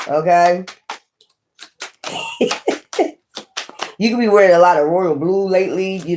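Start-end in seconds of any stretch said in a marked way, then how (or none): 1.8-3.1 a very high, thin squeal of laughter. Real, not acted. Reader cracking up at the cards with a high squeal. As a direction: burst into a high-pitched giggle. Laughs at her own reading.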